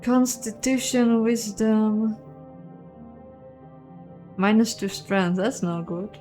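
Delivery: normal talking voice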